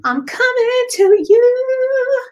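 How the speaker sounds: high, loud, long drawn-out call; playful sing-song. Real, not acted. Playfully announces whose reading is next.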